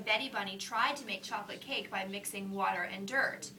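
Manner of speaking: plain reading voice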